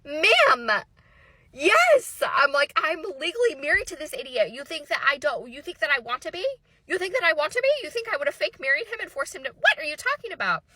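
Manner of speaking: high, loud exasperated retort; incredulous outburst. Retold or imitated. Ex-wife answering if they were legally married, incredulous. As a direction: throw it back in total disbelief.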